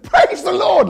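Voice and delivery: high, loud burst of joy; exultant praise. Retold or imitated. Telling the boss his mum got surgery, a high loud cheer. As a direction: throw it up like a cheer. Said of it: "like that, just like that".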